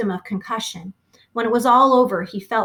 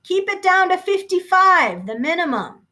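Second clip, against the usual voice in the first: high, louder call over engine roar; shouted over the wind; 10 semitones higher, 3 dB louder than usual. Acted. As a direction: yell it over the noise. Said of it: "he shouted".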